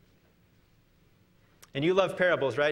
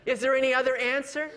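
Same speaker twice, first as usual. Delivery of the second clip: higher, faster comic plea; comic quote; 6 semitones higher than usual. Retold or imitated. Man on cliff asking for another answer, comic. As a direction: toss it off as a joke. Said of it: "he looks up and he says".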